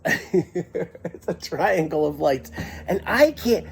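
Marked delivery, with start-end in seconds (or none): 0.0-2.5 slow, giggly recollection; giggling. Real, not acted. Storyteller recalling the terrifying lights to an audience, giggling. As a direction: giggle while getting the words out. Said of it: "why am I giggling now".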